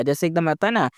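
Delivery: ordinary delivery, nothing marked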